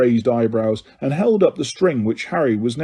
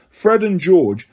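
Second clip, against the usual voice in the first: usual speaking voice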